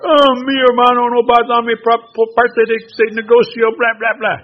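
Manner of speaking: high, loud hammed-up whine; mock crying complaint. Brother whining to the permaculture man about the business. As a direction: wail it like a put-upon brother. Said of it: "Su hermano llorando".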